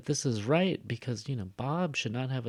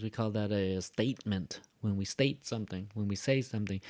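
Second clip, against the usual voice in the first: ordinary delivery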